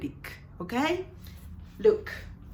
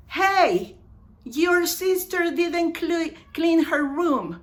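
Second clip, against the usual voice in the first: high, raised-voice complaint; acted accusation; 7 semitones higher, 5 dB louder than usual. Somebody complains about your sister's room, high and accusing. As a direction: call out the accusation. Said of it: "let's say".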